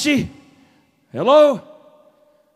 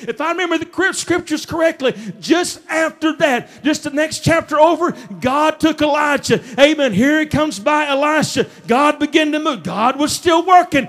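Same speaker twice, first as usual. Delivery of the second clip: raised, driving sermon rhythm; full-force preaching; 4 semitones higher than usual. Acted. Preacher declaring God's plan to church, full-force preaching. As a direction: build fervently to a proclamation.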